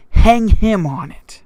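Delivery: slow, loud, high furious command; a king's furious shout, each word hammered. Acted. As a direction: bellow the order in a rage, slowly. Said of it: "furiously says".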